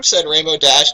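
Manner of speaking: normal talking voice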